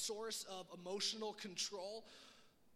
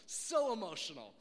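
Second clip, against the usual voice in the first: louder, slow, drawn-out so emotional; mock gushing; 5 semitones higher, 9 dB louder, slower than usual. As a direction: gush over emotional like a fake compliment. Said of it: "no one ever goes up to you and is like".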